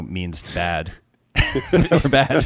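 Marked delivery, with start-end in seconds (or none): 1.3-2.4 laughing through the words. Real, not acted. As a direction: break into a laugh mid-line. Punchline after defining scrappy.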